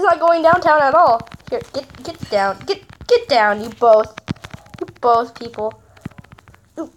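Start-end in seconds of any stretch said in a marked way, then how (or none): none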